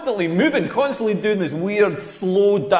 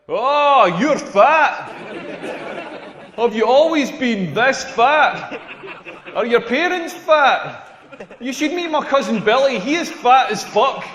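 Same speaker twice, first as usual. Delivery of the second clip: mock nosy stranger; 4 semitones higher, slower than usual. Imitating nosy strangers to fat person.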